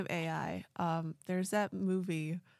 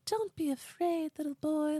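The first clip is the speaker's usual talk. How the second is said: ethereal alien; 8 semitones higher than usual. Retold or imitated. Alien comforting a frightened little boy, high and ethereal. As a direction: soothe the child like an otherworldly being. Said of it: "tall, like, ethereal beings".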